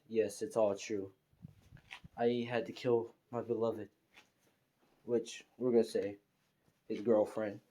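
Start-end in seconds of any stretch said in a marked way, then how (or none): none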